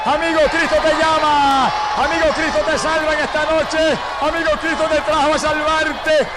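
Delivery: very high, shouted altar call; impassioned evangelist calling to the crowd; preaching at full force. Real, not acted. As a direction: cry out an urgent appeal, stretching phrase endings.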